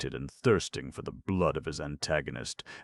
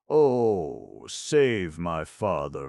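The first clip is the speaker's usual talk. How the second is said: loud, slow plea; pleading cry; 2 semitones higher, 7 dB louder, much slower than usual. A narrator's reading. Colombe begging Ascanio, loud slow plea. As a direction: beg him, anxious. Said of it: "cried Colombe".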